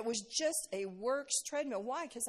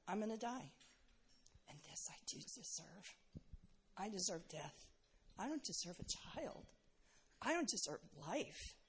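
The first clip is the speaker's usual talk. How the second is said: hushed, softer, half-breathed thoughts; despairing inner voice; 9 dB quieter than usual. Retold or imitated. Fainting mother thinking she deserves death, hushed despair. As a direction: drop to a near whisper of despair. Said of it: "And I thought".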